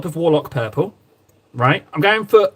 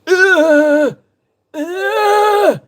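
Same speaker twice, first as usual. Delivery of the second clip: startled; 13 semitones higher, 5 dB louder than usual. Real, not acted. Stream died, panicked.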